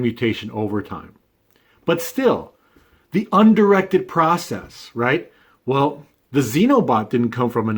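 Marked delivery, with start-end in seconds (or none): 3.1-4.9 slow phrase with "undirected" pitched high; strong stress on undirected. Real, not acted. Speaker pressing the point before the xenobot example, emphatic "undirected". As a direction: drive home "undirected", higher and drawn out. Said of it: "emphasizing the word undirected process".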